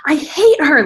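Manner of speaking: high, loud outburst of hate; imitated mad sister. Sister furious at the show's maker, mock angry cry. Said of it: "she was so mad at me".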